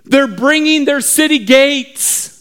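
high-pitched frantic report; alarmed lookout. Acted. Lookout tells the town about the gates, alarmed. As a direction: report disaster in rising alarm. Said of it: "he began to scream".

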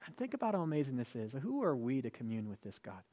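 ordinary talk, nothing marked

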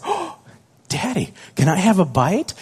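put-on high, eager little boy begging; son asking for a bite. Son begging daddy for a bite of protein bar, high and eager. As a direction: plead sweetly like a small child. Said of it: "he comes up and he's just like".

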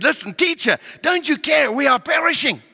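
high, loud cry of frightened disciples; panicked shout. Retold or imitated. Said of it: "They shouted at him and said".

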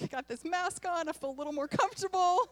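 high, loud and quick, almost laughing; bubbling over with relief; giddy relief. Retold or imitated. Relieved self-quote behind mask.

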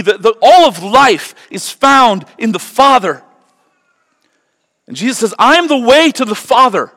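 high, loud, impassioned sermon cry; fiery pulpit preaching, high and loud; full-force preaching. Acted. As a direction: preach it out to the whole room.

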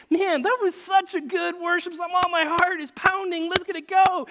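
loud, high excited gush; hyped worshipper. Retold or imitated. Worshipper gushing after a song, high and loud. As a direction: gush like a fired-up fan after church. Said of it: "we're like".

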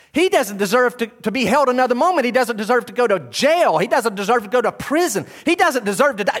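high, loud insistent protest; Pilate's thoughts. Acted. Pilate arguing with himself, loud insistence. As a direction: insist on his innocence. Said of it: "Pilate would think".

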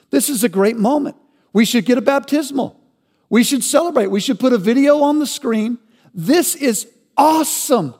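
preacher parodying a church crowd's cheering; mock celebration. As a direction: hype it up sarcastically. Mocking the crowd's imagined cheering.

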